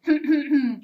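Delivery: a prim little ahem; polite throat clearing. Acted. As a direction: clear the throat before asking nicely. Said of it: "in his politest voice".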